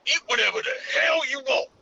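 loud high yell; shouting. Acted. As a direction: belt it out. Shouted goofy line.

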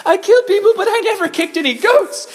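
very high, squeaky mock protest; bewildered Saul. Saul protesting back to God, high hammed-up squeal. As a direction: squeal it in comic confusion. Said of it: "And Saul's like".

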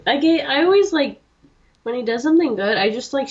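usual speaking voice

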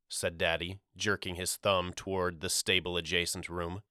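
ordinary delivery, nothing marked

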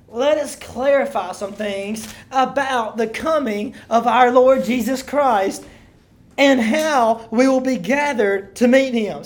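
loud, measured Bible reading; preacher reading out scripture; emphatic scripture reading. Acted. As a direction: proclaim the verse to a congregation. Reads scripture aloud emphatically to congregation.

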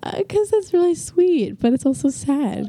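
high, slow, teary whine; tearing up while talking. Real, not acted. Teen and mother getting teary over parents. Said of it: "Okay, I'm gonna cry".

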